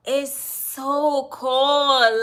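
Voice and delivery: slow, loud, drawn-out emphasis. Real, not acted. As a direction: stretch it out slowly and loudly. Describing tank water.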